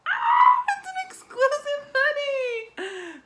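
loud, very high scream of delight; shriek, then draw the words out high; excited. Real, not acted. Fan learning the hoodie is exclusive, excited.

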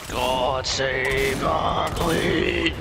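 very slow, grand and solemn; solemn mock anthem. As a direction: intone it like an anthem.

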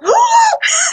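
sharp high gasp of shock; gasp, half laughing, at the rudeness; sudden loud gasp breaking into a laugh. Retold or imitated. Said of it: "and I'm like".

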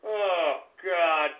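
ordinary talk, nothing marked

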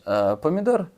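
ordinary talk, nothing marked

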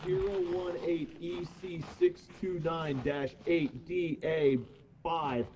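deliberate, drawn-out reading of a code; slow and measured recitation; slow code readout. Pretend. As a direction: spell it out slowly, character by character.

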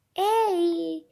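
loud, sudden call. Pretend. Calling someone out in play, loud shout. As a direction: give a loud playful shout of hey.

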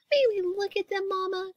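put-on high little-girl plea; crying out eagerly. A narrator's reading. Sister asking Mama to see puppies, eager cry. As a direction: ask eagerly as a small sister. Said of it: "cried Sister".

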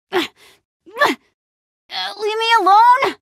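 high, strained cry; effortful angry protest; straining protest. Acted. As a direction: cry out while wrenching free. Grappled by an attacker.

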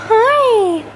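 high cooing hi. Real, not acted. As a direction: coo at the puppy. Greeting the puppy.

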